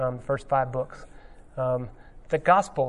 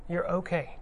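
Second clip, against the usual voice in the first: softer, falling "You're okay"; soft reassurance; 3 semitones higher, 8 dB quieter than usual. Retold or imitated. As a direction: let "okay" settle down quietly. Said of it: "As I'm fond of saying".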